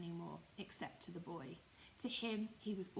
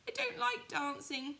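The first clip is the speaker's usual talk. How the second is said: higher, louder little rabbit refusing; high louder stubborn toy rabbit; 8 semitones higher, 7 dB louder than usual. Acted. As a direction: turn it down high and loud, hiding shame. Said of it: "he said".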